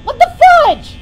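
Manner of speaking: high loud panicked yelling. Real, not acted. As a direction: freak out at the monster. Panicked at Foxy appearing.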